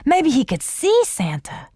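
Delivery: high, breathless hope; excited. A narrator's reading. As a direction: gasp with wonder. Child excited about Santa.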